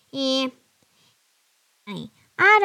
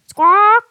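parrot squawk; 10 semitones higher, 11 dB louder than usual. Acted.

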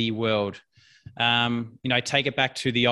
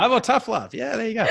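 laughing; 9 semitones higher than usual. Real, not acted.

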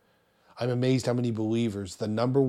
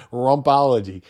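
usual speaking voice

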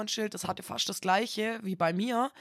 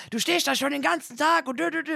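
higher, louder mocking rant; mock angry guy; 7 semitones higher, 5 dB louder than usual. A stranger shouting about a parked car, mocked. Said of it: "baut sich so vor mir auf".

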